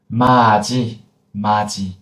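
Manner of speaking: 마지 with the consonant carried into the next vowel; final consonant fully pronounced. Shown as a demonstration. Teacher shows linking before a vowel, 마지 pronounced fully. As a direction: let 마지 flow uncut, sounding the j. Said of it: "pronounce the final consonant fully".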